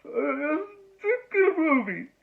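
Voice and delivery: choked-up. Retold or imitated. Moviegoer leaving the cinema, mock tearful. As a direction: squeeze it out while fighting tears. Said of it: "you go".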